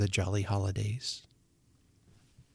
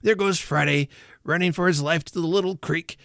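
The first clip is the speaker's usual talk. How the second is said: high, thrilled commentary; excited; 7 semitones higher than usual. A narrator's reading. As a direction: follow the chase with boyish glee. Scrooge excitedly watches his storybook heroes.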